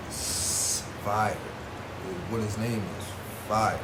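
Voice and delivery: ordinary delivery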